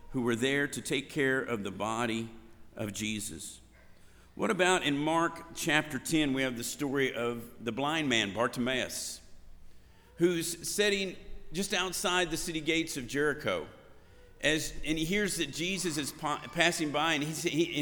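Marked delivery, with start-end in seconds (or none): none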